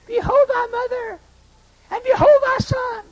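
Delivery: high, jeering parody; mocking. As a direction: throw the words back with a sneer. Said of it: "I mocked him".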